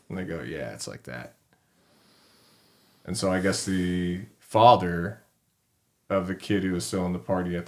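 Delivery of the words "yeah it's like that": low, soft, threatening growl-free mutter; menacing reply. Retold or imitated. The attackers answer his challenge, low and menacing. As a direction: lower and quieter, cold threat.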